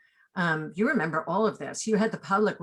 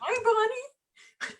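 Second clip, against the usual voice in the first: playful greeting; much slower than usual. Pretend. Greeting absent casting director playfully.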